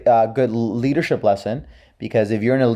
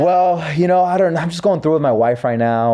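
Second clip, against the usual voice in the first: hesitant confession; 6 semitones higher than usual. Acted. Voicing someone reluctantly opening up.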